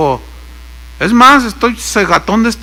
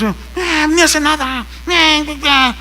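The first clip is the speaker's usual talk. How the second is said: mocking bored churchgoers; 7 semitones higher, 3 dB quieter than usual. Mocking bored congregation members.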